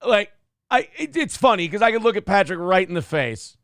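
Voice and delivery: ordinary talk, nothing marked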